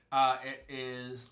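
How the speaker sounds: talking around a lozenge; sucking a throat lozenge. Real, not acted. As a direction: lozenge in the mouth. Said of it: "I just popped a, uh, lozenge".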